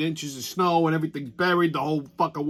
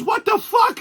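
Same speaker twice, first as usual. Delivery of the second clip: high, loud frantic "What the fuck?"; distraught buddy; 4 dB louder than usual. Retold or imitated. Snowed-in buddy panics down the phone, high and loud. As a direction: squeal it like a man losing his mind. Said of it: "He goes".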